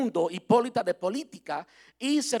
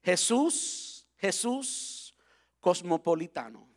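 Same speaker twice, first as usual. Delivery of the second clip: slow, drawn-out title reveal; announcing the title; slower than usual. Acted. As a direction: unveil the series name slowly. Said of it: "le quiero anunciar el nombre de la serie".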